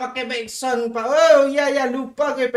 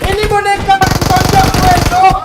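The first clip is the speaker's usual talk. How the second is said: loud high frustrated shout; outraged yell; 12 semitones higher, 18 dB louder than usual. Real, not acted. Getting punched in game.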